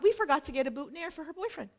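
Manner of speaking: ordinary talk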